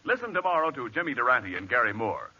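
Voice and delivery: usual speaking voice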